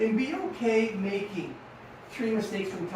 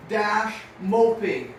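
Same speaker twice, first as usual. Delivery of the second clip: dash moping drawn out slowly and a bit louder; slow dictation; 3 dB louder, much slower than usual. Shown as a demonstration. Coach dictating homework to riders, slow and deliberate. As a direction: spell it out slowly for the class to write.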